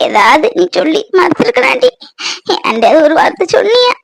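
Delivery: high-pitched crying, full of hurt; tearful. Acted. Mother pouring out her heartache to the daughter who left, tearful. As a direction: sob through a mother's reproach. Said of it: "கண்ணு வடிச்சுக்கிட்டு இருக்க".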